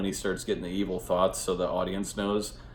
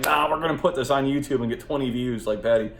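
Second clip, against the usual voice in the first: put-on rowdy kids; 6 semitones higher, 6 dB louder than usual. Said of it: "all the kids are fucking".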